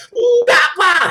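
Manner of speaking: high, loud frightened protest; scared kids' no. Retold or imitated. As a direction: cry out no like a caught boy. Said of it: "Langsung aja dua-duanya bilang".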